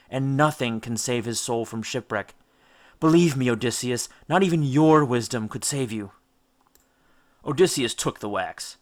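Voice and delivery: usual speaking voice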